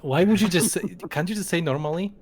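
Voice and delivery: exasperated. Real, not acted. Exasperated at weird phrasing.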